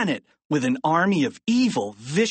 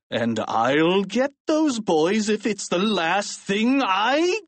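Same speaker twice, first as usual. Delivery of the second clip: slow, higher, rising principal's threat; angry principal; 6 semitones higher, slower than usual. A narrator's reading. Narrator quoting the principal's choice words, angry and rising. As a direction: build slowly to a high, cut-off threat.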